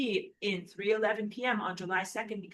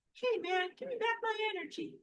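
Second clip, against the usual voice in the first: high squeaky cartoon plea, fast on the second line; a goofy little stick figure whining for its energy back; silly mock voice; faster than usual. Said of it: "I have to be a little silly".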